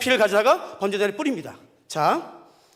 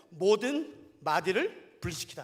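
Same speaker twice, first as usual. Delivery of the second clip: slow reading; 5 semitones lower, 7 dB quieter, slower than usual. Shown as a demonstration. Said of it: "잘 들어보세요".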